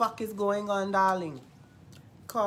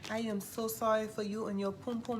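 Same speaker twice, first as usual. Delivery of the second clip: normal talking voice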